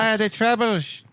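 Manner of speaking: high, slow put-on Irish accent. Friend trying out the Troubles line, mock Irish accent. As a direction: have a go at a hammed-up Irish accent. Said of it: "Is that good?".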